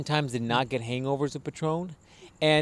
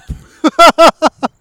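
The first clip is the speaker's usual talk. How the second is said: loud high laugh; 18 dB louder than usual. Real, not acted. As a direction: burst of shrill laughter. Laughing at own drunk act.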